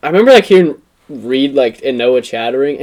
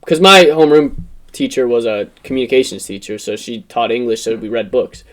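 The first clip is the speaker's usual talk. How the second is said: ordinary delivery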